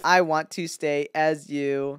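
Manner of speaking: low, slow, soft menace; low, deliberate fake-human voice; skeleton impostor. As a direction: warn a rival off in a stilted monotone. Impostor clone's voice.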